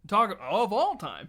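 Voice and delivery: high, loud, drawn-out insistence; insistent gushing. Retold or imitated. Fan overselling his favourite band, slow and high. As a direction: stretch it out with mock fervour.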